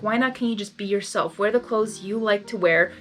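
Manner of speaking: ordinary delivery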